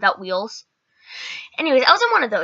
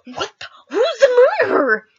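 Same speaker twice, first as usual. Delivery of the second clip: high, louder frantic question; panicked confusion; 11 semitones higher, 5 dB louder than usual. Retold or imitated. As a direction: thrown into panic. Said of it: "I'm like".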